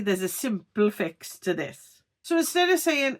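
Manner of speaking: usual speaking voice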